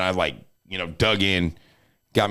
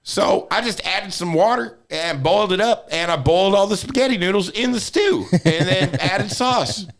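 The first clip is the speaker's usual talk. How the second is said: high-pitched grandma explaining; grandma's voice; 9 semitones higher than usual. Retold or imitated. Grandma explaining her spaghetti to grandson, high imitation. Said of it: "That's how she talked".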